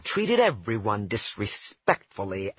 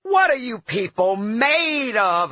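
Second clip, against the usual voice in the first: higher, louder outraged shout; 10 semitones higher, 4 dB louder than usual. Retold or imitated. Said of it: "I shouted".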